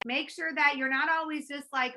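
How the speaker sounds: ordinary talk, nothing marked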